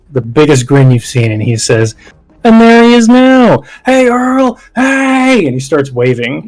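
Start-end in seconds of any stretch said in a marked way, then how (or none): 2.4-5.4 high, loud cheerful hail across the distance; calling out. Acted. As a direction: wave and shout a greeting to a friend. Eddie calls out to Earl.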